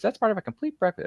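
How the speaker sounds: high-pitched retro TV ad announcer; cereal commercial voice. Retold or imitated. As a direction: sell it like an old cereal ad. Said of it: "those little ads we used to hear".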